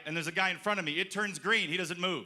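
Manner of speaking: ordinary talk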